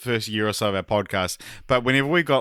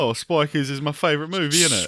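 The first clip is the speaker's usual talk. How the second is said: put-on higher-pitched, common British accent; 6 semitones higher than usual. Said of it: "Can you guys do a British accent?".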